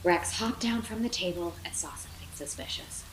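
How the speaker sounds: plain reading voice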